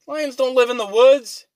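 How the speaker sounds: high, loud indignant outburst; outraged yell. Retold or imitated. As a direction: blast the phone for its stupidity. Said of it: "you're like".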